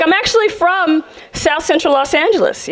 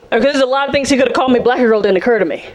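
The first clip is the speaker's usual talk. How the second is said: ordinary delivery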